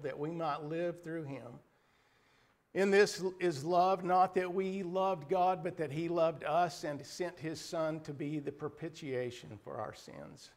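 ordinary talk

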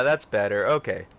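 normal talking voice

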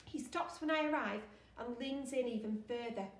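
plain reading voice

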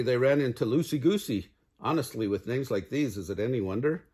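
ordinary delivery

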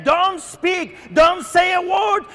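high, urgent mock rebuke; acted silencing. People around the blind man silence him, acted silencing. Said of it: "The people around him were saying".

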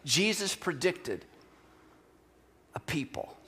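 quiet echo of the preacher's main point; soft repeat. Acted. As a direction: let it sink in, dropping to a whisper. Same point repeated softly.

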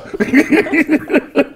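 high cackling laugh. Real, not acted. Laughs at his own joke.

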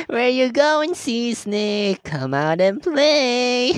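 high, slow, slimy taunting villain; slimy slurring voice. Acted. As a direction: drawl it out with a creepy laugh. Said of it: "that slimy slurring voice".

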